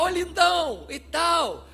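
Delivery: voz de enfermeira bem mais alta e forte, toda melosa; enfermeira exagerada. Retold or imitated. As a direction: bajulando o paciente para convencê-lo. Enfermeira imitada, tom exagerado. (enfermeira engambelando o menino, em tom alto e forte)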